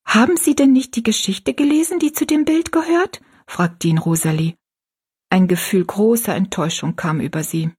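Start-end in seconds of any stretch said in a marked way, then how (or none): 0.1-3.2 put-on high-pitched, earnest girl's question; lifted girlish voice, rising question. A narrator's reading. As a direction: ask as a puzzled young girl. Narrator voices Rosalie asking Jinx.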